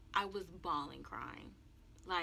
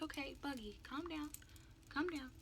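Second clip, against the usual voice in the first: soft, higher-pitched soothing voice; calm mother; 7 semitones higher, 7 dB quieter than usual. Retold or imitated. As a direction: soothe quietly, as a mother to her crying child. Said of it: "My mom was so calm".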